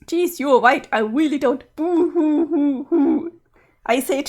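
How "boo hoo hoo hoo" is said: slow mock boo-hoo. Sprite agrees, cries on.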